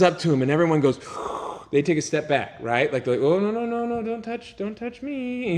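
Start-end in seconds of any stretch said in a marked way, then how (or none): 3.2-5.6 higher, pleading, backing away; a mock-scared don't touch me; frightened crowd. Crowd recoiling from a leper.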